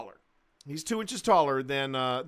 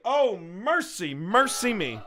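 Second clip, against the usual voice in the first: ordinary talk, nothing marked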